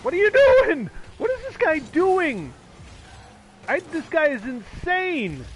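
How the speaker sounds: high, drawn-out cries of disbelief; exasperated yell. Real, not acted. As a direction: wail loudly about an insane opponent. Yelling at opponent during game.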